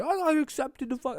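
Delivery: high, airy show-off voice; mock bragging. Mocking seniors bragging about getting accepted. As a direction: toss it off like a smug senior. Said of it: "everyone was just like".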